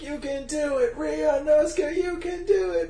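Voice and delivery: high, pleading pep-talk voice; hammed-up heartfelt encouragement; cheering on. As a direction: urge the struggling little dragon on. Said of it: "they're getting behind him".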